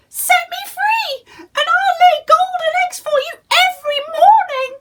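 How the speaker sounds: very high, loud squawk; clucking hen. Acted. Golden hen calling to Jack, high squawk. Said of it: "she clucked".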